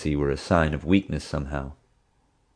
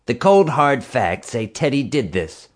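huffy; 7 semitones higher than usual. A narrator's reading. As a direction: brush her off with cold certainty. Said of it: "Marge huffed".

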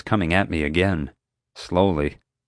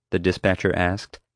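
ordinary delivery, nothing marked

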